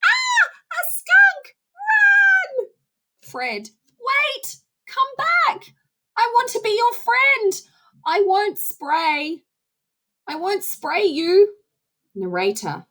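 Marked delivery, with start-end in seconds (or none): 0.0-2.7 much higher, shrieking alarm cry; panicked bunnies. Acted. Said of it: "Bunnies one, two, and three". 4.0-11.6 much higher, eager pleading cartoon voice; friendly young skunk. Fred pleads with fleeing bunnies.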